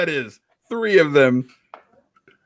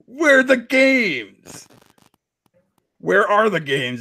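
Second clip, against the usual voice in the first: dramatic reading; 5 semitones higher, slower than usual. Acted. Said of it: "dramatic readings of Console Warrior tweets".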